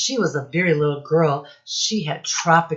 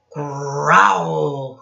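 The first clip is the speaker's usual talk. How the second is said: louder, drawn-out dog growl; 5 dB louder than usual. Acted. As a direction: growl it like a dog spotting the cat. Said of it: "Who says growl?".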